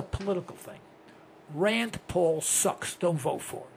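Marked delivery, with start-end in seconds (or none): 1.5-2.9 very slow, word by word; slow and deliberate. Real, not acted. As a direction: hammer the verdict home. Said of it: "Let me say this again".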